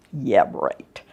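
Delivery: low, dry sarcastic "Yeah, right". Acted. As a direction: say it like you don't believe it. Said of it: "I had to be sarcastic there".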